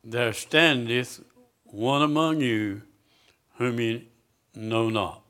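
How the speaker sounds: slow, weighty scripture reading; slow emphatic preaching; slow deliberate reading. Shown as a demonstration. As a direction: let each word land slowly. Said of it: "tell me what the next word is".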